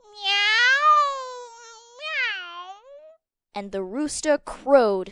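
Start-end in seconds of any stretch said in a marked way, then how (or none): 0.1-2.8 long meow sliding down from high; cat meow. Acted. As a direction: mew like a cat. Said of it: "The cat mewed".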